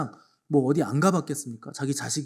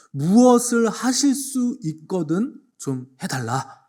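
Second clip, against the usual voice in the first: slow, higher hesitant plea; pleading father; 6 semitones higher, 4 dB louder, much slower than usual. Retold or imitated. Father begging Jesus, slow doubtful plea. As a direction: ask half-doubting, drawn out. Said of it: "뭐라고 요청했냐면".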